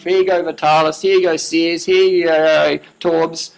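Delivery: usual speaking voice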